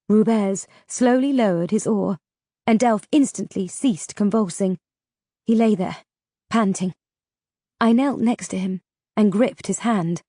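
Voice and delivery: plain reading voice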